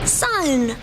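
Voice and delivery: high, startled call of his name; Lily surprised to see him; surprised yell. A narrator's reading. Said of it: "she yelled in surprise".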